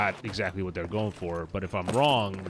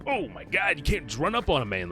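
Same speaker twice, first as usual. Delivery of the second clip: startled; 7 semitones higher than usual. Real, not acted. Startled by an enemy rushing him.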